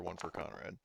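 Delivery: usual speaking voice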